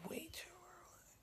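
barely awake whisper; groggy, breathy morning whisper; sleepy whisper. Real, not acted. Just woke up, early morning.

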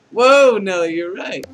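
over-the-top shouted realization; booming exclamation. Acted. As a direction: belt out the astonishment, then settle. Louder retake of the exclamation.

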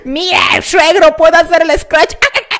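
high, loud, squealed boast ending in squawks; hammed-up excited show-off to the father-in-law. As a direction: brag loudly, then shriek "ah, ah". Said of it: "y de repente digan".

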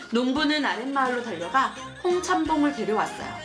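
ordinary delivery

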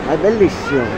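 ordinary talk

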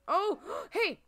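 high, loud yelp of surprise; startled exclamation. Real, not acted. Player spotting villager Fang talking, startled. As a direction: burst out high and sudden.

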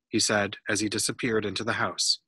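usual speaking voice

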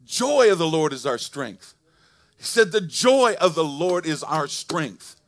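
loud, high preacher's call to rejoice; full-force preaching. Acted. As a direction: preach it out to the whole church.

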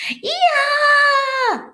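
shrill high-pitched loud scream. A narrator's reading. Girl whose pigtail is yanked, screaming. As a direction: let out a long, loud high shriek. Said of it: "screamed Leslie".

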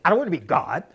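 emphatic mock objection with a laugh near; imitated actor's protest. Director repeating how the actor refused, protesting. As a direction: playfully mimic a star balking at a note. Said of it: "That's how he said it".

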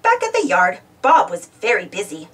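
usual speaking voice

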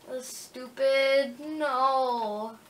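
ordinary talk

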